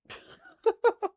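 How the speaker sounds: high, loud disbelieving laugh; incredulous laugh. Real, not acted. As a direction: burst out laughing at the absurdity. Laughing at the low ranking.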